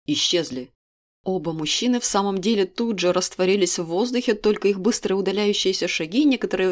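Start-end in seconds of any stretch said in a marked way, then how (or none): 0.1-0.7 lower, curt command to his men; king dismissing guards without turning; icy order. Acted. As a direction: toss it over the shoulder, ice cold. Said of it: "ледяным тоном бросил за спину".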